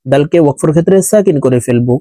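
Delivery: ordinary talk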